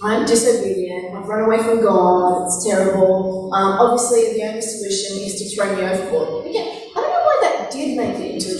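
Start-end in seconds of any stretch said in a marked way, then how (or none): 0.0-6.5 lower, mock-gloomy confession; hammed-up remorseful Jonah; glum Jonah. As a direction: sigh out the blame like a sulky prophet. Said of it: "and he's like".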